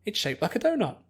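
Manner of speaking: distracted girl spotting a cloud, thrilled; bubbly Pinkie.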